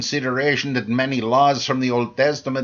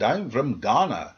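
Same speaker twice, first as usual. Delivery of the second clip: put-on Ghanaian accent. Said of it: "I love African accents when speaking English".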